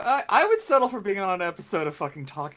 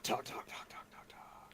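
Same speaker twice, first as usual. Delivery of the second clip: echo effect; 20 dB quieter than usual. Pretend. Echo effect after trailer talk.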